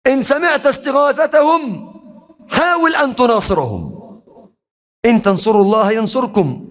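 high, rising sermon cry; preaching at full force. Real, not acted. Preaching climax. (خطبة حماسية بصوت أعلى بكثير)

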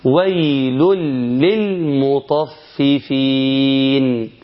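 slow recitation, tanween merged into lam; Quranic idgham. Shown as a demonstration. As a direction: chant "ويل للمطففين" slowly with a doubled lam. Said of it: "ناخد مثال". (تلاوة بطيئة بإدغام التنوين في اللام)

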